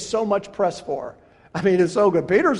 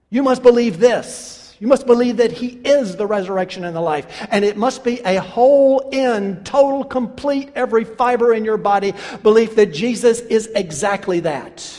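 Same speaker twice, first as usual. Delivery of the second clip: louder, ringing pulpit delivery; full-force preaching; 5 dB louder than usual. Acted. Preacher proclaiming Jesus's words to the congregation, full-force preaching. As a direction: preach it out to the back row.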